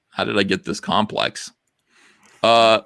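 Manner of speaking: normal talking voice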